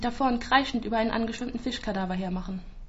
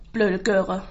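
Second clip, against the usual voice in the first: lower, louder annoyed mutter; annoyed grumble; 3 semitones lower, 5 dB louder than usual. Acted. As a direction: spit it out, fed up. Anne annoyed by screaming baby. (genervt, lauter gemurmelt)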